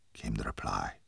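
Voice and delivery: plain reading voice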